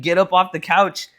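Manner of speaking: normal talking voice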